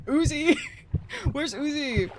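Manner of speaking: real laughing, drawn-out call for someone. Real, not acted. Gamer calling for Uzi to fix a broken gun, laughing call-out.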